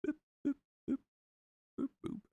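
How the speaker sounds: high boops while painting; sound effects. Acted. Painter making his own high boop sound effects. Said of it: "you gotta make the sound effects".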